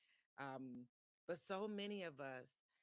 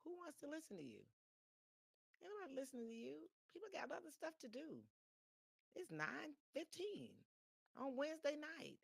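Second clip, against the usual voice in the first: higher, mocking inner voice; inner critic voice; 10 semitones higher than usual. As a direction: play the nagging doubt in your head, pitched up. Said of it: "that little voice in my head kept saying".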